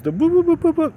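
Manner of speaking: high, silly boop-boop sounds; goofy slapstick boops, way up in pitch; Three Stooges noise. Retold or imitated. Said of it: "like the Three Stooges".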